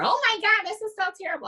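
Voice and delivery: high, hammed-up panicked wail; mock panic. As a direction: play the horrified blamer. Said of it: "trying to give blame or".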